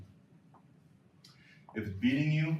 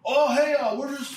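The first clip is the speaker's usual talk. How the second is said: much higher, friendly and apologetic; polite Canadian; 13 semitones higher, 7 dB louder than usual. Retold or imitated. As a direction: answer like an overly nice Canadian. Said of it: "polite Canadian".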